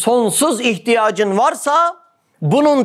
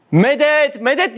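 higher-pitched plea for help; pleading cry; 7 semitones higher, slower than usual. Acted. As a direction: call out imploringly, pitched up. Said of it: "diye yalvarmam lazım".

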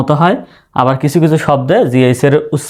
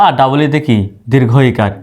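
normal talking voice

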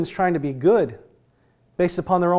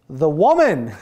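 blaming Adam; 5 dB louder than usual. Retold or imitated. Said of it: "the first words out of his mouth is".

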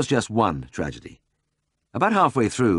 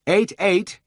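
high, raised-voice callout; calling out figures; 14 semitones higher, 4 dB louder than usual. A narrator's reading. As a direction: call the reading out to the note-taker. Vet calling out measurements again.

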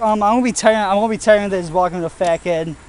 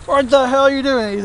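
mock yelling boss; 6 semitones higher than usual. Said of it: "They're like".

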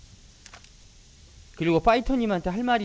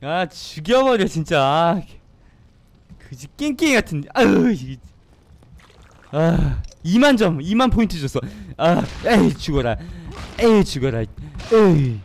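loud, frustrated shouting; angry yelling; 2 semitones higher, 8 dB louder than usual. Real, not acted. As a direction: vent your anger at the game. Yelling angrily at the game.

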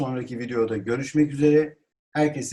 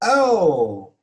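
loud astonished exclamation; surprise; 3 semitones higher, 6 dB louder than usual. Shown as a demonstration. Teacher demonstrates astonishment, surprised. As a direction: let out a drawn surprised cry. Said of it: "Yani şaşkınlık".

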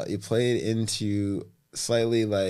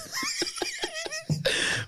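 high burst of laughter; real laughter at a joke; 6 dB quieter than usual. Real, not acted.

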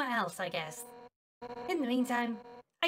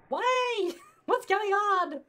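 surprised; 8 semitones higher, 6 dB louder, much slower than usual. Real, not acted. Surprised by game event.

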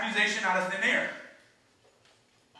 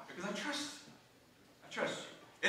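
whispered trust; 12 dB quieter than usual. Pretend. Child voice, quiet resolve.

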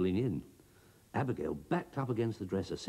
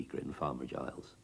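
usual speaking voice

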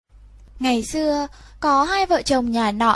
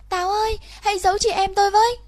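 higher, fast frantic cry for help; shrill call; 8 semitones higher, much faster than usual. Acted. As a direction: call out in a panicked rush. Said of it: "rít gọi". (cô bé rít gọi cây táo, giọng cao gấp gáp)

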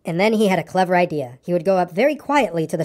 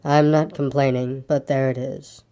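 low, slow, resigned donkey; gloomy; 5 semitones lower, slower than usual. A narrator's reading. Eeyore ends his explanation to Pooh, low and resigned. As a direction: let it sink low and heavy. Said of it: "went on Eeyore gloomily".

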